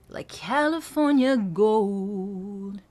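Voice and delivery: very slow, "gold" with a round, rich vowel. Shown as a demonstration. Voice coach rounding the vowel in "gold". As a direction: open up the o in "gold". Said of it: "round out that vowel".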